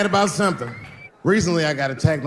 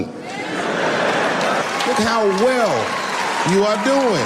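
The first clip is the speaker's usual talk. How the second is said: very slow, stretched out; mock envious drawl; 5 semitones higher, much slower than usual. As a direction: stretch each word with jealous awe.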